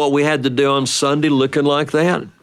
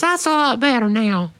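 put-on much higher small boy's voice; a little kid marvelling at his fuzzy head; little brother voice; 12 semitones higher than usual. Little brother feeling his cut hair, high childlike wonder.

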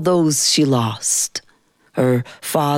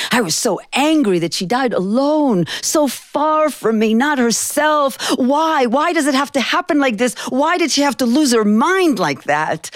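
much higher, crying out why; anguished anger; 9 semitones higher than usual. Acted. A granddaughter raging that her grandmother died alone, anguished. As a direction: let the grief burst upward into questions. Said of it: "And I was angry".

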